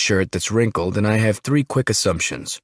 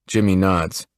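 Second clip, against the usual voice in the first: plain reading voice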